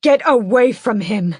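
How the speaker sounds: loud furious scream. A narrator's reading. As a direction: drive her away from him. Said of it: "I scream".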